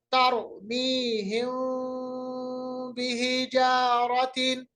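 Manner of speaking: slow, meem of तरमीहिम slides lightly into the ba; light meem toward ba. Shown as a demonstration. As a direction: lips barely meet, slight nasal glide to बिहिजारतिन. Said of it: "थोड़ा सा जो आवाज़ है वो बा की तरफ जाएगा".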